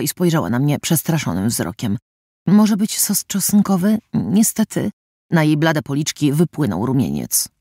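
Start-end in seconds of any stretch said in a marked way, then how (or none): 2.5-4.9 slow, higher, hesitant asking; nervous girl fearing a scolding; shy waitress asking slowly and higher; timid question. A narrator's reading. Timid girl asks about sauce.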